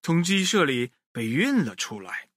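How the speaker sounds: ordinary delivery, nothing marked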